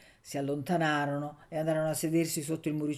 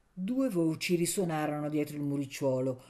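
ordinary delivery

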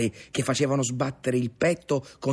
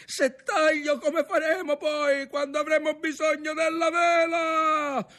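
high, shouted objection; exclaimed protest; slower than usual. A narrator's reading. Ntoni objecting to cutting the sail, shouting. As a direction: shout back over the storm in protest. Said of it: "esclamò Ntoni".

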